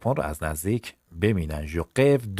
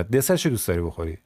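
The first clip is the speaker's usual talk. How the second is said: normal talking voice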